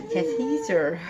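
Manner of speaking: normal talking voice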